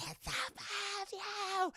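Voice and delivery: high, slow, crying warning; high slow crying whisper; eerie whimper. Retold or imitated. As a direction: warn her slowly, near tears. Creepy warning to sister.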